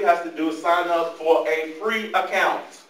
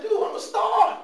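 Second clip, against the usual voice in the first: indignant boast. Retold or imitated. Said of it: "I'm like".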